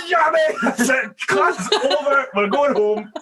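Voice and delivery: exasperated shout. Retold or imitated. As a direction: announce defeat in an exasperated yell. Giving up on the class.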